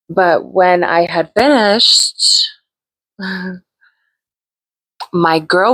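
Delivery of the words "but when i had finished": ordinary talk, nothing marked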